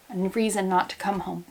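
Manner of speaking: normal talking voice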